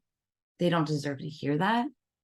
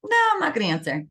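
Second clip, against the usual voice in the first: higher, faster mock refusal; mock annoyed refusal; 7 semitones higher, 4 dB louder, faster than usual. Friend refusing a late-night call, quick mock annoyance. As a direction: brush off the late caller. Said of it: "'cause you're like".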